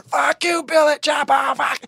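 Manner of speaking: high, loud crowd jeer; shouted stadium taunt, imitated; mock heckler shout. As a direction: yell it like a furious fan.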